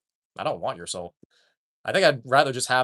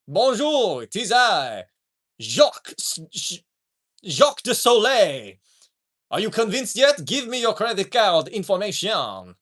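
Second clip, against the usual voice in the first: high, drawn-out mock Frenchman; bad French accent; 5 semitones higher, slower than usual. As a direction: introduce yourself as a hammed-up French scammer. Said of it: "I can't do a French accent".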